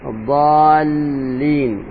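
slow, with the dad and the lam in الضالين each held long; slow careful recitation. Shown as a demonstration. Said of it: "اللام مشدد". (الضالين ببطء وتشديد)

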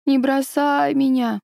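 put-on delirious child pleading, high; feverish. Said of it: "ребёнок в бреду". (бредовая мольба ребёнка, высоко)

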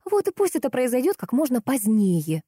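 lightly grouchy retort; grumbling. A narrator's reading. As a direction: grumble back, put out. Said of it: "проворчала Лиза".

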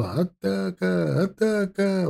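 high, slow, drawn-out call; signal call. Acted. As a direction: stretch it like a bugle signal. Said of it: "выдавал сигнал эскадронный трубач".

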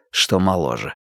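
ordinary delivery, nothing marked

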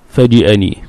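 فجأني with an i in the middle syllable; kasra on jim. Shown as a demonstration. Teacher returns to the purer form, with kasra. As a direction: say فجأني as "faji'ani", short i after the j. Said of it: "بالكسر أفصح".

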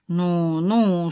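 low, vague murmured response; noncommittal. A narrator's reading. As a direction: let it hang, committing to nothing. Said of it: "сказал он неопределённо".

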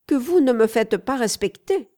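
loud, indignant rebuke; young lady indignantly scolding her escort; offended rebuke. A narrator's reading. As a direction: scold him for not defending her.

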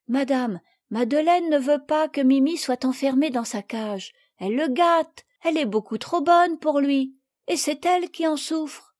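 a hint of a earnest little girl; young girl explaining. Marguerite explaining Mimi to Madame, earnest.

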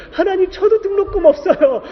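telling God he has no tuition either, laughing and crying; laughing-crying plea. Retold or imitated. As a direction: plead with God through a laugh and a sob. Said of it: "이런 심정이 드는데".

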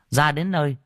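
plain reading voice

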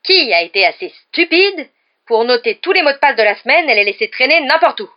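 high, loud outraged demand; quivering fury. A narrator's reading. Professor demands who lost the passwords, furious. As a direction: scold with rising outrage. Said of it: "dit-elle d'une voix frémissante".

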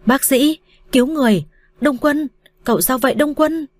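high-pitched alarm, narrated without extra volume; panicked cry. A narrator's reading. Tú calls for a doctor as a friend collapses, mildly panicked cry. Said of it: "một tiếng kêu thất thanh của tên Tú".

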